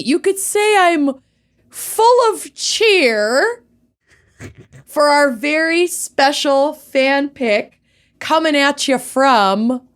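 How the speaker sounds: high, slow cheerleader-bright voice; cheery sing-song. Pretend. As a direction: sound bursting with cheer.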